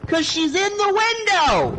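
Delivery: high-pitched mock outrage at a husband; wife flipping out. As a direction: imitate a wife losing it. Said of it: "she just starts flipping out".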